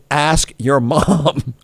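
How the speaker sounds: slow, deliberate Ask your mom; deadpan quote. Retold or imitated. Host reads the tweet aloud, slowly and deadpan. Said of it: "The other response was".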